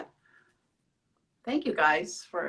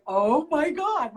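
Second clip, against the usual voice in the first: slow amazed gasp; 8 semitones higher, 5 dB louder, much slower than usual. Retold or imitated. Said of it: "And I was like".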